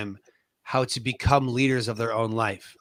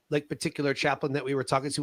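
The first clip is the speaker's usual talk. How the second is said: ordinary talk, nothing marked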